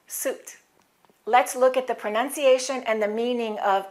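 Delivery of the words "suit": suit as soot, plain OO, unvoiced; American suit. Shown as a demonstration. Teacher answering her own question with American suit. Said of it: "In American English, it's what?".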